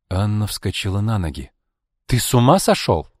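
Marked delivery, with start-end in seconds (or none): 2.1-3.1 louder, higher, indignant challenge; outraged question. A narrator's reading. Anna protests as Arkady banishes his son, outraged.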